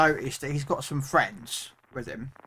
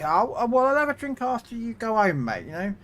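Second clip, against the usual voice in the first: matey bouncer; 8 semitones higher than usual. Retold or imitated. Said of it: "talk to him like a kid".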